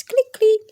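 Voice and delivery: high, loud clicking sound effect; stapler clicks. Acted. Narrator voicing the stapler, high loud clicks. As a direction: pop each click like a stapler.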